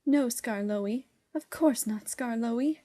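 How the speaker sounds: cower and agree at once; meek quaver. A narrator's reading. Coaches answering Skarloey, meek and quavering. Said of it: "quavered the coaches meekly".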